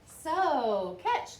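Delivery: loud, rising call of catch; Once-ler calls to the boy, catch. A narrator's reading. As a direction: toss the word across to him. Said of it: "called the Once-ler".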